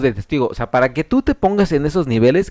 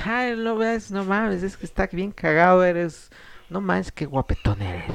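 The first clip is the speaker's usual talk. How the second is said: tipsy flirty date; 5 semitones higher, 8 dB quieter, slower than usual. Retold or imitated. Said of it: "Sino se pone así de". (chica borracha coqueta)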